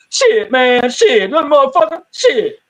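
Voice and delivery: high street-talk voice, mock cursing; off-camera reporter. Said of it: "they'll go off camera".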